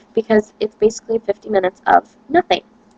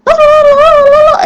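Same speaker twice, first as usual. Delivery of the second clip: much higher, louder put-on teacher voice; mock foreign teacher; 7 dB louder than usual. Serbian teacher inviting the student in, hammed-up high voice. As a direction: gush at them like an overeager teacher. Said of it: "She goes".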